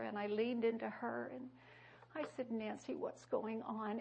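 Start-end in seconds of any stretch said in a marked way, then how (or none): 2.5-4.0 soft gentle question. Retold or imitated. Leaning into crying friend.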